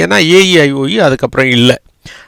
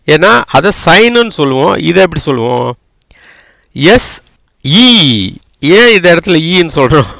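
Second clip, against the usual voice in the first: usual speaking voice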